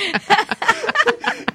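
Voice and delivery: loud, very high laugh; high shrieking laugh. Real, not acted. Host laughing at a co-host's yoni egg joke, loud and high.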